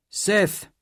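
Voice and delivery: loud call across the house. A narrator's reading. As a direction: call his name from the door. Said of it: "a deep voice called".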